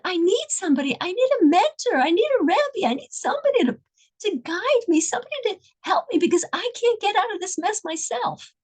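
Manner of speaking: high, desperate plea for help; pleading. Retold or imitated. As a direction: beg for someone to guide him. Pleading for a mentor.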